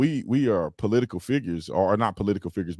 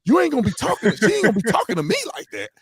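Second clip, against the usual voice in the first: high, loud fake outrage in the car; mock angry rant; 11 semitones higher, 4 dB louder, faster than usual. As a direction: rant at the absent wife, half joking. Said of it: "saying all kinds of stuff".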